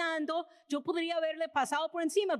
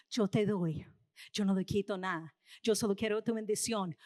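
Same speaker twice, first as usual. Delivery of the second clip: much lower and gentle, speaking as God; voice of the Lord; 7 semitones lower than usual. Acted. The preacher voices the Lord offering blessing, low and gentle. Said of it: "Pero el Señor te dice".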